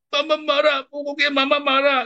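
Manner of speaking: very high mock-tantrum child voice; Miracle. Imitating a child who won't accept correction, mock tantrum. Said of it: "kamu itu begitu namanya tantrum itu".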